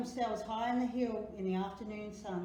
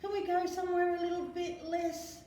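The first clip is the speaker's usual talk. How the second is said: raised pitch, a bit louder; a child asking to leave; high pleading; 8 semitones higher, 4 dB louder than usual. A narrator's reading. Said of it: "said Em".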